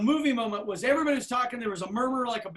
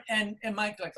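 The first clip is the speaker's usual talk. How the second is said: normal talking voice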